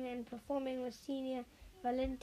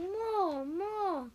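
higher, louder crowd chant; shouting crowd; 6 semitones higher, 3 dB louder than usual. A narrator's reading. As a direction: cheer for more like a delighted audience.

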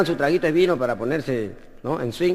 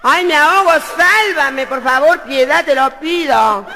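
high, louder camp cry, stretching auxilio and socorro slow; drowning plea; 8 semitones higher, 5 dB louder, slower than usual. Acted. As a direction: wail for help theatrically. Drowning man begging. (grito afeminado y agudo de auxilio)

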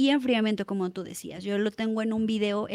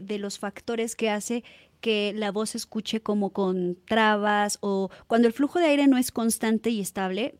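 ordinary talk

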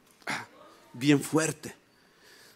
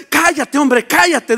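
raised, higher-pitched acted rebuke; scolding; 8 semitones higher, 9 dB louder, slower than usual. Religious crowd silencing the blind man, scolding. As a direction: shush him loudly and impatiently. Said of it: "me regañaban".

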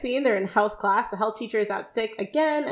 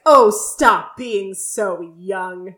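slow mock scold; 5 dB louder, much slower than usual. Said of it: "Sean's like".

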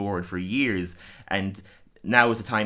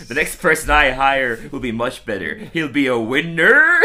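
pompous superintendent; 3 semitones higher, 8 dB louder than usual. Retold or imitated. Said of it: "he's like".

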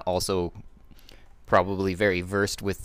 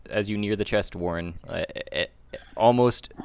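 normal talking voice

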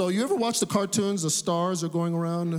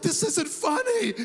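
indignant protest; 10 semitones higher than usual. Retold or imitated. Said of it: "I'm like".

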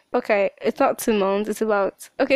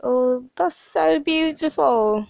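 slow, higher, touched gush; moved; 4 semitones higher, slower than usual. Retold or imitated. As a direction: savor it, almost in tears. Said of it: "there are times that I'm like".

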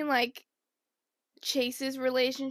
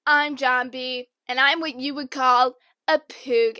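louder, slower mocking copy; 9 dB louder than usual. Stealing John B's line, loud and slowed to mock him. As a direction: parrot his line back loudly to annoy him. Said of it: "I'm like".

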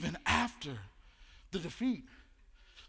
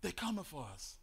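fearful murmur; 3 semitones lower, 10 dB quieter than usual. Retold or imitated. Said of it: "start to hear the rumors".

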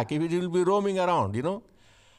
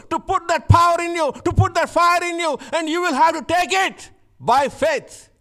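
high, loud preaching climax; fiery preaching; 9 semitones higher, 5 dB louder than usual. Acted. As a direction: preach it with full fire, rising. Preaching at full force.

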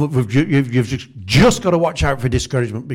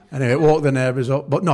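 ordinary talk, nothing marked

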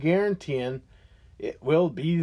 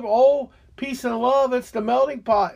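mocking liberals; 6 semitones higher than usual. Ranter mocking liberals who praise the melting pot, sarcastic mimicry.

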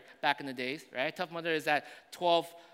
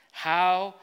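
usual speaking voice

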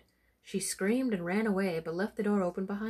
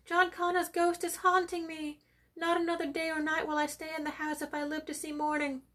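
terrified screaming; 10 semitones higher than usual. Acted. Servant girl shrieking at the ghost at the door, terrified. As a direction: shriek it out as if you've seen a ghost. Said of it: "then began to scream".

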